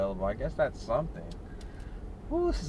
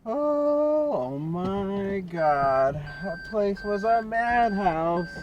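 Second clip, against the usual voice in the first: exasperated exclaim; 6 semitones higher, much slower than usual. Real, not acted. Exasperated after leaving crowded store.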